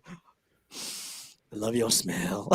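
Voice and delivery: low, slow creepy murmur; creepy low whisper. Acted. Mock pickup line.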